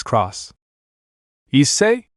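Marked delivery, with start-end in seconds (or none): 1.5-2.1 startled, pitched higher, rising; surprised. A narrator's reading. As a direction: caught off guard, call his name. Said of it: "she said in surprise".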